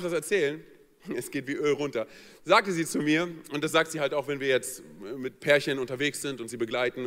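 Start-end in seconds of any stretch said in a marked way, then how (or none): none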